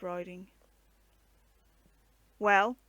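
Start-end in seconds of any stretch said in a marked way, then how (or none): 2.4-2.8 a loud, curt challenge; challenging. A narrator's reading. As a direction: corporal demands an answer from Guy, loud. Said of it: "the corporal challenged again".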